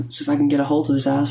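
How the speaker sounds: usual speaking voice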